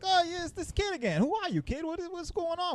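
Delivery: high-pitched mock Italian dad. Dad greeting the kid again, in a mock Italian accent. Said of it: "You're making him very Italian".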